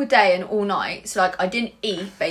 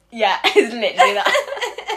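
laughing; 2 semitones higher, slower than usual. Real, not acted. Laughing as she agrees with her friend's hiccup story. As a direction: laugh the line out.

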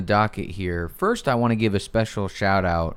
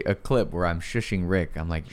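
usual speaking voice

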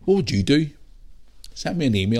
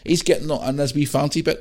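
ordinary delivery

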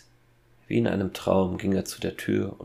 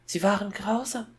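very high put-on little girl voice; high girl's voice; 14 semitones higher than usual. A narrator's reading. Boy speaking as his sister to his father, high girl's voice. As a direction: squeak it up like a girl. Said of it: "mit der hohen Stimme eines Mädchens".

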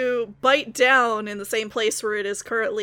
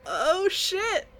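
surprised; 8 semitones higher than usual. Real, not acted. Reacting to the spell.

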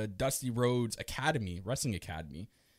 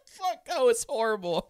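laughing; 11 semitones higher than usual. Real, not acted. Laughing at own math blunder.

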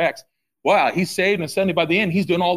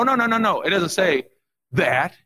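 ordinary talk

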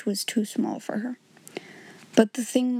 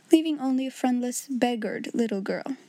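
plain reading voice

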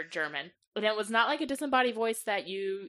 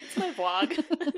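playful insistence; 8 semitones higher, 4 dB louder, much slower than usual. Real, not acted. Repeating the joke, stretched out.

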